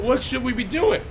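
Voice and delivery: ordinary talk, nothing marked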